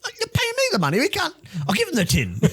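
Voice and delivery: very high, squeaky put-on voice with a laugh at the end; squeaky cousin voice. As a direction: squeak it like a cartoon character haggling. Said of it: "He's like".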